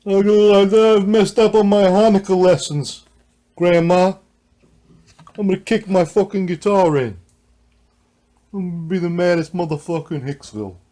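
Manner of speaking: loud, high, brash Long Island guy voice; Long Island accent. Pretend. Guitarist mocks a Long Island owner, loud Long Island accent. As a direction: play an angry Long Island kid yelling at grandma in a Long Island accent. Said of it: "The Long Island, like, you know what I mean, accent".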